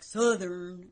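slow, stretched Southern drawl; thick mock-Southern drawl; exaggerated Southern accent. Shown as a demonstration. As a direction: overdo a Southern twang. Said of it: "It's Southern, but it's not, like, Southern".

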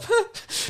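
high, puffed little laugh; one brief amused burst, imitated; short laugh. Driver shrugging off the rude truckie, short mock laugh. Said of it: "just sort of had a bit of a laugh".

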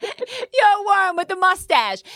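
high and slow through giggles; a teasing giggle at his mustache; laughing. Real, not acted. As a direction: laugh the words out.

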